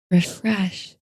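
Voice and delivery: ordinary delivery, nothing marked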